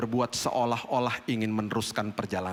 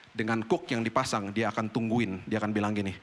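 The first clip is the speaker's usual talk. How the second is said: ordinary talk, nothing marked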